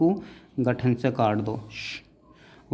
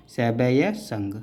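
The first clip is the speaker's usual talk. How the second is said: slow broken-up word; much slower than usual. Shown as a demonstration. Teacher slowly sounding out सैवय संघ for students. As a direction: say सैवय संघ slowly, parts held apart. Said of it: "या एक यह भी खोल दो".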